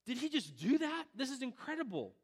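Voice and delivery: like seeing a miracle live; amazed gasp. Retold or imitated. Amazed onlooker voiced.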